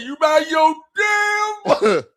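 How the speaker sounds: slow, loud shout of mind your damn; shouted quote. Retold or imitated. Cohost repeating Matt's line as a slow loud shout. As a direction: yell it slowly, drawing out damn. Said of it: "Matt hit that".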